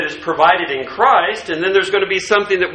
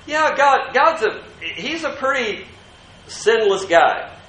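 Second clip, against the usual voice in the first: mock-breezy lightly higher voice, casual shrug; mocking a casual view; 7 semitones higher than usual. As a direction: lightly send up a chummy idea of God. Mocking the culture's easygoing view of God.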